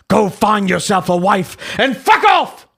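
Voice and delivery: hammed-up irate Brit, punching each word; British voice. Host as Buckby telling Lewis off, irate British voice. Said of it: "read Buckby's voice in a British voice".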